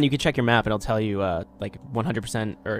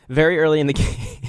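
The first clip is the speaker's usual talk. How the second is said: laughing; 5 semitones higher, 6 dB louder than usual. Real, not acted. Laughing while repeating his line.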